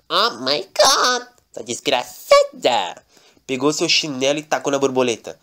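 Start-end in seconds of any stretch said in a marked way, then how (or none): 0.1-3.0 bem mais agudo e lento, exclamação de personagem; Lorenzo espantado. Acted. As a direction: arrasta o Oh my God lá no alto, indignado. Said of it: "E Lorenzo veio e falou assim". (Lorenzo xinga a mariposa, voz aguda e arrastada)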